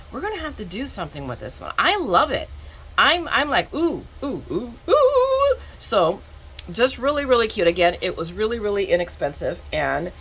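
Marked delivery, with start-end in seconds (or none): 3.7-5.6 rising string of high oohs; excited oohing. Real, not acted. Crafter delighted by the big new piece, excited oohs. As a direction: ooh higher and higher with excitement.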